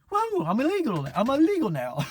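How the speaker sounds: put-on high, startled old-lady voice; surprised grandmother. Grandma hearing Reagan on TV, high startled voice. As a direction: yelp it like grandma realizing. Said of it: "Grandmom goes".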